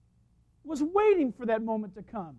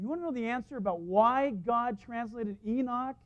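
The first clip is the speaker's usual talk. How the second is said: usual speaking voice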